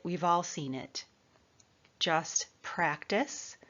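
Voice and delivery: ordinary talk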